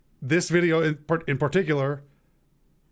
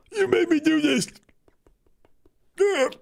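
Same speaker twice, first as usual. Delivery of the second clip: much higher, silly squeak; high squeaky voice; 14 semitones higher, 6 dB louder than usual. Pretend. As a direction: squeak it out like a cartoon. Continuing the squeaky bit.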